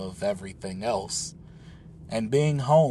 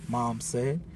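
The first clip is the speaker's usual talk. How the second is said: usual speaking voice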